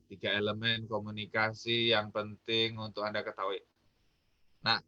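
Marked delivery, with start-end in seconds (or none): 0.1-3.6 low, soft, unvarying drone on "tiga elemen komunikasi"; flat monotone. Shown as a demonstration. Coach showing listeners flat intonation, "tiga elemen komunikasi" in a dull monotone. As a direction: say "tiga elemen komunikasi yang penting untuk Anda ketahui" on one low, level note. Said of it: "kalau saya ngomongnya datar aja".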